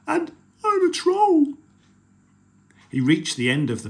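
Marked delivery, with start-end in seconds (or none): 0.0-1.6 small troll voice. Acted. Troll pleading.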